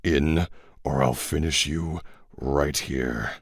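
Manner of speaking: low, soft and slow threat; cruel near-whisper. Acted. Chapman threatens to finish the cat, hushed and cruel. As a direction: hush it like a promise of harm. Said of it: "he said in a cruel whisper".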